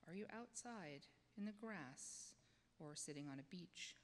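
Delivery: soothing voice that invites the congregation to close their eyes; guided meditation. Acted. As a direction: ease them gently inward, dropping to a whisper at times.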